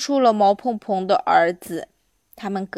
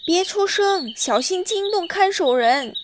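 high, urgent warning; anxious, raised-pitch caution; cautious warning; 8 semitones higher than usual. Acted. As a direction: warn them to keep silent. Onion boy warns against waking keeper.

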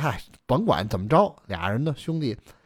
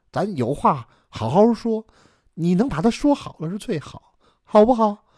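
higher-pitched, coaxing wife; conciliatory wife; 5 semitones higher than usual. Acted. Wife asking husband to spare his brother, coaxing. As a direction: coax the husband, pitch lifted throughout.

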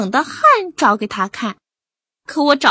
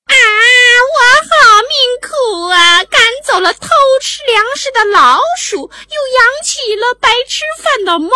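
much higher, whiny, mock-lamenting master; sarcastic owner impression; 12 semitones higher, 5 dB louder than usual. Cat imitating its master's sarcastic whining. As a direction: mimic the snide owner moaning. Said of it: "模仿着主人那阴阳怪气的腔调".

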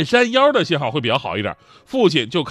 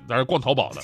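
usual speaking voice

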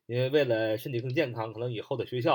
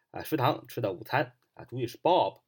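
ordinary talk

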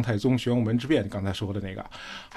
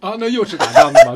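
laughing teasing; 11 semitones higher, 9 dB louder than usual. Real, not acted.

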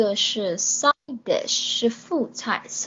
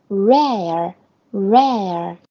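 slow model word; much slower than usual. Shown as a demonstration. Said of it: "三成熟就叫rare".

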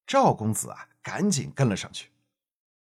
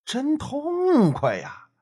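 slow drawn-out admiring exclaim; 11 semitones higher, much slower than usual. A narrator's reading.

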